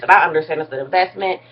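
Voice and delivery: ordinary talk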